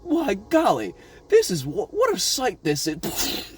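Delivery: slow, hammed-up gawking voice; lightly voiced old-timey gawker; mock awed bystander. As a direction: play a dumbstruck onlooker. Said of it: "looking up like".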